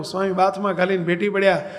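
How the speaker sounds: ordinary talk, nothing marked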